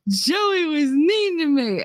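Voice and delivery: high, slow, tearful whine; slow weepy child's complaint; crying child. Retold or imitated. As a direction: tell on him through tears. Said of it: "I'm all crying".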